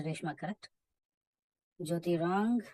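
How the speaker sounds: ordinary delivery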